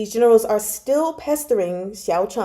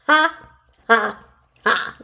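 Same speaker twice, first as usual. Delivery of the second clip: triumphant laugh; 3 semitones higher, 4 dB louder, slower than usual. Real, not acted. Reader gloating as the schemer gets barred from the palace. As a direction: crow over the bad guy getting caught. Gloating over a villain's downfall.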